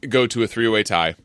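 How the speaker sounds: normal talking voice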